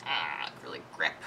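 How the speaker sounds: strained, unvoiced effort; mock straining. Shown as a demonstration. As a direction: squeeze it out under tension, barely voiced. Demonstrates straining to grip too hard.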